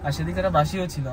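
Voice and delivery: usual speaking voice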